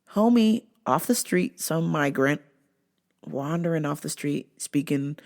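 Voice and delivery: ordinary delivery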